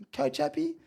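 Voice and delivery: very high-pitched question; high comic coach impression; imitating the coach. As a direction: squeak it as a leading question. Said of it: "He goes".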